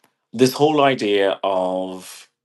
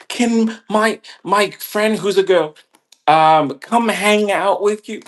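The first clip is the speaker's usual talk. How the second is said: high, loud mock pleading; exaggerated pitched-up social-climber voice; mimicking pushy hanger-on; 13 semitones higher, 6 dB louder than usual. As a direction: put on a high wheedling request.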